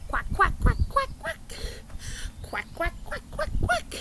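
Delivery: high, squawky duck quacking; duck quacks. Shown as a demonstration. Teacher showing the kids how the duck says quack, high and squawky. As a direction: quack like a silly cartoon duck. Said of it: "And what does the duck say?".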